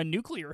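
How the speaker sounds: "nuclear" with "klee" in the middle, softly corrective; standard pronunciation. Shown as a demonstration. Cohost corrects the mispronounced word.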